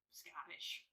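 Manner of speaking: breathy whisper of Scottish; whispered aside. Acted. Scottish gin, aside to camera.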